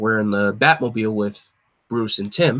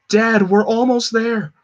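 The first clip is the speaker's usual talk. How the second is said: much higher, slow, straining son; desperate plea; 10 semitones higher, 4 dB louder, slower than usual. Acted. Tim calling to his dad as he arrives, desperate plea. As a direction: reach out, almost there.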